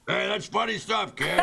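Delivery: high-pitched mock heckler's approval; imitated banquet men. Comic imitating the men in the room, hearty mock call. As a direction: call out like a man in the audience. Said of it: "the men in the room went".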